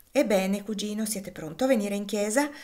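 ordinary delivery

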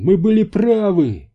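high, loud cry of triumph; exultant exclamation. A narrator's reading. Квентин learns they were right, exultant. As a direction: exclaim it, vindicated. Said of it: "воскликнул Квентин".

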